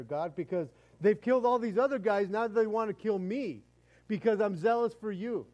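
loud, high, aggrieved protest; indignant self-justification. Acted. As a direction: argue back at God, insisting on your zeal. Elijah's acted complaint to God continues.

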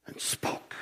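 whisper. Acted. Awed hush describing creation.

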